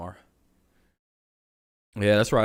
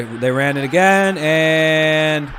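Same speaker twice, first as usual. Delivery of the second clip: rising, drawn-out build into a loud call; sportscaster-style held-out shout; live touchdown call; 4 semitones higher, 7 dB louder, much slower than usual. Real, not acted. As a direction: stretch it out, then belt the score.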